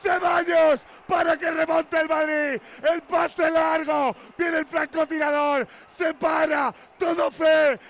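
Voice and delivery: shouted goal call, building excitement; breakneck goal commentary. Real, not acted. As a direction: scream the goal call live.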